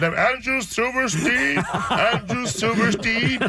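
drawn-out, high-pitched paging call; airport PA announcer. Retold or imitated. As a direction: imitate a slow, high airport page. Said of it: "he got on the, on the P A system".

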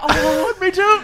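very high, delighted squeal; excited. Real, not acted. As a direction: cheer at the shared pick. Everyone wants the same sunglasses.

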